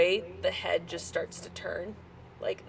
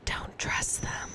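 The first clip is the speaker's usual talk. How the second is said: eerie whisper; 3 dB quieter, slower than usual. Acted. GM voices an unseen whisper.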